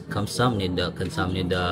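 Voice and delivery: mababa at patag na tono ng taga-Seoul; Seoul intonasyon. Shown as a demonstration. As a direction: gayahin ang simpleng tono ng Seoul. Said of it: "Ganun lang sa Seoul". (tonong taga-Seoul, mababa lang)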